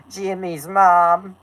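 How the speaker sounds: high, drawn-out cartoon character voice; slow, stretched character impression; Carl Wheezer. Shown as a demonstration. Speaker proves they can do the character, high cartoon impression. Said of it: "I can do the Carl voice".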